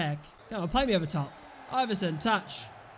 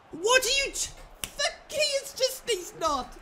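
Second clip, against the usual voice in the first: exasperated outburst; 13 semitones higher than usual. Real, not acted. Outburst at player.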